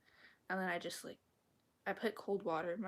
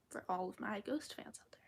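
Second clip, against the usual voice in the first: whispering; 4 dB quieter than usual. Real, not acted. Whispered chat on stream.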